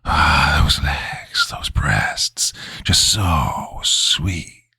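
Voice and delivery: slow, lecherous whisper; leering breathy man's whisper; breathy male voice. A narrator's reading. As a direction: breathe it out low and lewd. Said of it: "it was a breathy male voice".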